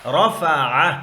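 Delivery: "رفع" chanted loudly as a model; reading demonstration. Shown as a demonstration. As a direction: sound out "رفع" firmly for pupils. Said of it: "اس کو کیسے پڑھتے ہیں؟". ("رفع" بلند آواز میں پڑھ کر دکھائیں)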